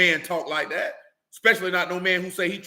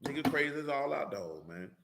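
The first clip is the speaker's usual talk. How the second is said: muttered aside; 11 dB quieter than usual. Real, not acted. Preacher to himself after the refusal, muttered aside. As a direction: let it fall away to yourself.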